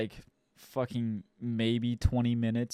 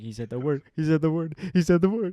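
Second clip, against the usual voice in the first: building higher and louder with each repeat; excited geeking out; 5 semitones higher, 5 dB louder, faster than usual. Retold or imitated. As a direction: get more hyped every time you say it. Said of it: "He's like".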